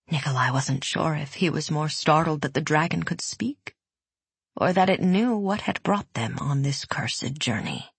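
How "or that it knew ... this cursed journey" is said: ordinary delivery, nothing marked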